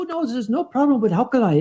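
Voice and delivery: ordinary delivery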